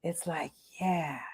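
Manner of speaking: very soft, low zen mumble; slow and deliberate. Shown as a demonstration. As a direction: drop right down, low and mellow. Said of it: "slowly and deliberately".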